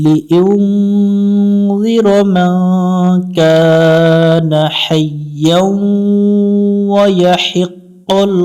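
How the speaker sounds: slow melodic tajweed chant; Quran recitation. Acted. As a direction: draw out every vowel in recitation. Recites the verse. (ধীর সুরেলা কুরআন তিলাওয়াত)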